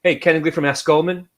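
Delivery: "A S Goldman" run together fast so it sounds like "Goldman"; fast sales pitch. Shown as a demonstration. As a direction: rattle off "A S Goldman" like a pushy broker. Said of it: "We used to say it fast so people would say it Goldman".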